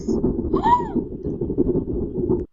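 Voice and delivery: high shocked gasp; sharp gasp. Real, not acted. Shock in game.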